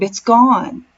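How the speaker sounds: mildly exclaimed, pitch lifted; a restrained exclamation in storytelling; exclaiming. A narrator's reading. Husband tells wife the dinghy has gone, exclaiming. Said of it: "Paul exclaimed".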